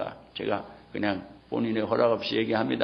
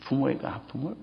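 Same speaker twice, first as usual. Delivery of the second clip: ordinary delivery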